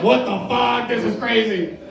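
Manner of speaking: high, acted freak-out; amazed outcry. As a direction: cry out as if the trip blows your mind. Acting out amazement during the trip.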